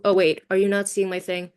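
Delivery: normal talking voice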